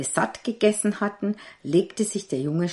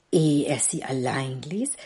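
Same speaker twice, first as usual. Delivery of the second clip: ordinary delivery, nothing marked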